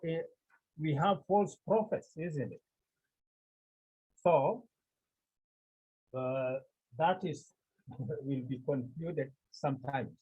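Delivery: ordinary talk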